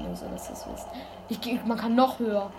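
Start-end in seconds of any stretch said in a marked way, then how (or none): 0.0-0.9 a hushed just-so-you-know; whispered aside. Real, not acted. Streamer whispering an aside to viewers.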